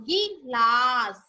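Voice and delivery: put-on very slow, drawn out for children to copy; slow model word. Shown as a demonstration. Teacher modelling the word slowly for pupils. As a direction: say it slowly so pupils can repeat. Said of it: "ये क्या बना".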